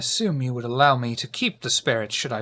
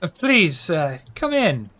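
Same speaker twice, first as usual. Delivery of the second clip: put-on higher, slow hammy French accent; 4 semitones higher, 4 dB louder, slower than usual. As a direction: play the pompous French host. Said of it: "stop talking like a buffoon".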